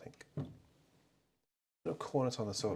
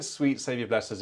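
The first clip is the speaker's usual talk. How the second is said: ordinary delivery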